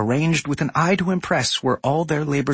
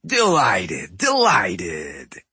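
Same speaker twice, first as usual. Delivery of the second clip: booming raspy voice; 4 semitones lower, much slower than usual. A narrator's reading. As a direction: boom it out, raspy and smug. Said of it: "he boomed in his abrasive, raspy voice".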